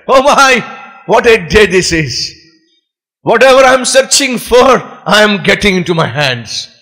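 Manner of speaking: high-pitched delight easing down at phrase ends; acted excitement. Man delighted at finding another bangle, excited exclaiming. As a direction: marvel at your own good luck. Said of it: "He was so excited".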